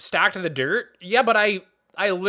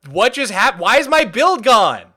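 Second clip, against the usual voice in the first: exasperated; 3 semitones higher, 7 dB louder than usual. Real, not acted. Repeating the complaint louder.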